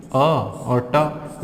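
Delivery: अ and ट stretched very slowly; sounds split apart. Shown as a demonstration. Teacher splits ईट into sounds for students, slowly. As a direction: spell ईट out sound by sound. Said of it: "इसको कैसे पढ़ते हैं?".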